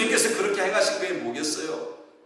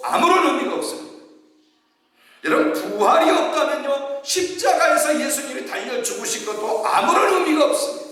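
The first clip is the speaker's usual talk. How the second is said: rising fervent preaching, much higher; 7 semitones higher than usual. Acted. Pastor stressing the resurrection to the congregation, fervent. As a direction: build up with passion, pitch climbing.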